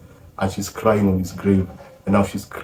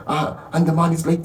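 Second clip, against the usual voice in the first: ordinary delivery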